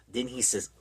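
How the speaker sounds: ordinary delivery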